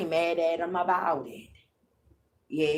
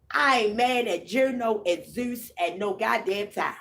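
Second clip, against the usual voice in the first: ordinary talk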